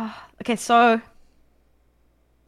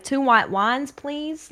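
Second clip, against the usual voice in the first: badly put-on American accent, louder; 5 semitones higher, 4 dB louder, much slower than usual. Ordering wine so the Californian man understands, fake American accent. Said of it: "put on an American accent".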